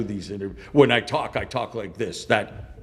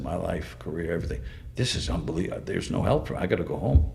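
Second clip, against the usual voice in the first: low, soft, defeated; quietly resigned, sinking voice; retold despair; 7 semitones lower, 6 dB quieter, faster than usual. Fighter giving up and booking a flight home, quiet despair. Recalls giving up after the incident.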